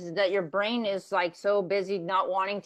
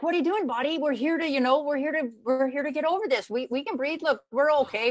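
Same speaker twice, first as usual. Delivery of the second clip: higher, urgent self-pep talk; pleading with her body; 7 semitones higher than usual. Retold or imitated. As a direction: coax your own body onward, pitched up. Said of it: "And I'm like".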